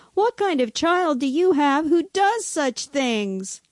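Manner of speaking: high-pitched indignant question; accusing. A narrator's reading. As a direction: demand an answer from the parent. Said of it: "began to accuse him".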